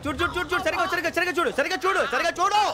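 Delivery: high, rapid-fire repeated commands; urgent ordering. Acted. Bully pestering someone to check properly, urgent and high-pitched. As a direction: press the order harder with each repeat.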